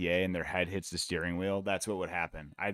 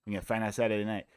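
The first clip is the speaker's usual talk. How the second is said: Holloway impression; much faster than usual. Streamer repeating Holloway's catchphrase, fast and light. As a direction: toss the line off quickly, lightly lisped. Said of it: "He's got a little bit of, like, a Nate Diaz, uh, lisp".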